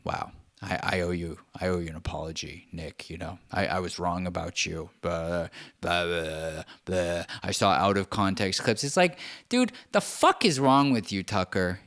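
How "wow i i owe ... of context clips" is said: soft, low mock-sincere apology; hushed, lower mock contrition. Tucker apologizing to Nick, hushed and low. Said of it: "you're gonna be like".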